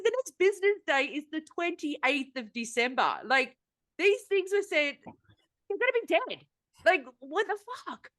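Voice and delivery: high-pitched, incredulous retort, re-enacted; exasperated protest. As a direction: replay an exasperated complaint, pitch climbing right up. Retells her protest to the courier.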